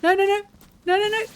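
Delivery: high, loud panicked no-no-no; real burst of gaming panic; alarmed. Real, not acted. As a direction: blurt it out high and urgent. Alarmed reaction during gameplay.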